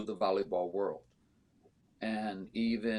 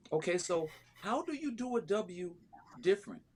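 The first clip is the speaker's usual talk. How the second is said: high-pitched retold disbelief; baffled retort; 9 semitones higher than usual. Coach retelling her retort to the team owner, incredulous. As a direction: question the absurd complaint, voice pitched well up.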